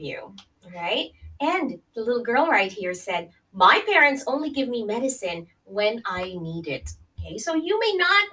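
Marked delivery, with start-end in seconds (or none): none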